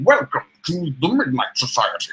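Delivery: put-on host of a spooky club; pinball voice. Co-host welcoming listeners to the Midnight Society, pinball voice. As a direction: welcome them in a mock-spooky character voice. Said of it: "Just doing my pinball voice".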